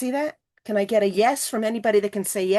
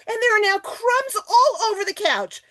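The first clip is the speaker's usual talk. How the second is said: mock horror; 10 semitones higher, 3 dB louder than usual. Shown as a demonstration. Toddler brings crumbs onto couch.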